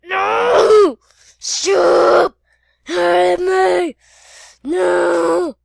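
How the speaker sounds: high, loud cries of pain; wailing, hammed-up agony; pained screaming. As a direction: scream for the attack to stop. Victim screams while being hurt.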